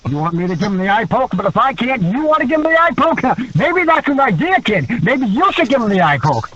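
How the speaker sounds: rising, worked-up wrestler impression; Roddy. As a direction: build from musing to high, gleeful scheming. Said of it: "He's like".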